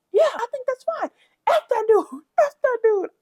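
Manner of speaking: very high, loud squeaky mimicry; mocking Summer. Imitating Summer dissing a dead father, very high and loud. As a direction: squeak it out like a petty girl. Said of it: "Summer really said".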